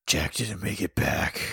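mock somber Sky. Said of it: "And he's like".

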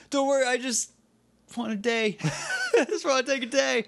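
high, laughing put-on voice; mock tired hero voice, high and giggling. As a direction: squeak it out through laughter. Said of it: "He's like".

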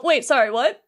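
usual speaking voice

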